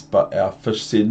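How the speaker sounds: plain reading voice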